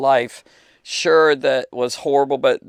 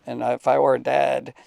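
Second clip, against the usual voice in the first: usual speaking voice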